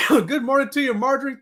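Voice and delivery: normal talking voice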